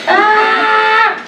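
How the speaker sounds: put-on high shrieking squeal like a frightened girl; over-the-top mock scream; mock girly scream. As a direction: scream like a panicked little girl, hammed up. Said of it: "gritar como puras niñas".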